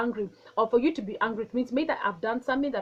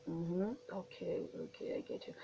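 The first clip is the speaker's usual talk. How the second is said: calm soft acknowledgment; 5 semitones lower, 15 dB quieter than usual. Shown as a demonstration. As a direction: soothe an angry person, barely voiced. Demoing calm listening responses.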